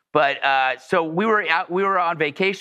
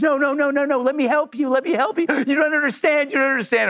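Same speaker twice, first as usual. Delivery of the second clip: high-pitched imitation of desperate begging; frantic pleading; 9 semitones higher than usual. Woman begs the departing driver to let her help, frantic. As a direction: plead frantically, repeating yourself.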